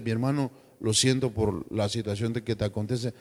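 soft, low consoling words to a brother; tender comfort. Pretend. (consolando con ternura)

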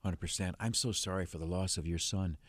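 quiet, gentle condolence; soft sympathetic. Real, not acted. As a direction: speak softly to a grieving parent. Host consoles grieving caller.

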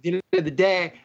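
usual speaking voice

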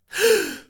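short high shocked gasp; sharp gasp. A narrator's reading. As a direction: gasp in horror at the sight. Mother sees Paul. (erschrockenes Aufkeuchen)

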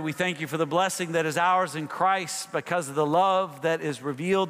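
steady, lifted prayer voice; public prayer. Acted. Pastor leads the standing congregation in prayer. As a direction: lead the room in thanks.

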